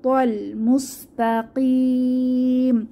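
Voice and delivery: slow chant ending on a long stretch; tajweed reading; Quran recitation. Shown as a demonstration. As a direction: recite slowly. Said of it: "তিন আলিফ টেনে ওয়াকফ".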